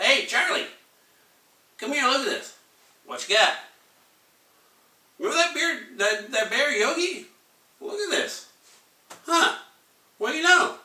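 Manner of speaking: high, loud excited nerd; excited lab scientist. Acted. Lab guy showing Charlie the bear data, high excited voice. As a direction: call your colleague over, thrilled. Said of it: "two guys in lab coats".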